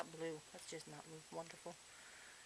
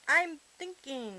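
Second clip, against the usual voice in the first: parrot voice; 6 semitones higher, 6 dB louder, slower than usual. Pretend. Voicing the parrot's reply.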